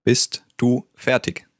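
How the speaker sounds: "Bist du fertig?" slowly, word by word; slow, separated words for learners to repeat; slow demonstration. Shown as a demonstration. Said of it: "hear the question one more time".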